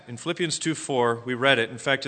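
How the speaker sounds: ordinary talk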